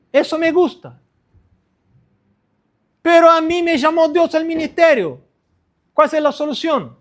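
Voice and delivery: voz aguda de predicador apasionado; prédica enfática. Real, not acted. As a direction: predica con vehemencia, subiendo el tono. Predica con fuerza creciente. (sermón encendido en tono alto)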